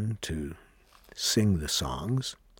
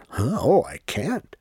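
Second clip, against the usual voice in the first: higher, louder startled protest; startled refusal; 6 semitones higher, 5 dB louder than usual. Retold or imitated. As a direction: retell it as a taken-aback objection. Retelling own startled refusal.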